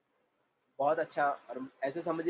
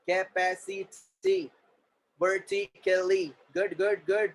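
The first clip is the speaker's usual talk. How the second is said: higher, word-by-word classroom dictation; syllable-by-syllable drill; 3 semitones higher, 3 dB louder, much slower than usual. Shown as a demonstration. Teacher breaking words into syllables for students, drilled evenly. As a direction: sound out each syllable for the class.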